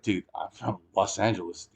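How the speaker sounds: soft, low, cool threat; calm warning. Retold or imitated. To a man who came at him, quiet, cool warning. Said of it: "and I was like".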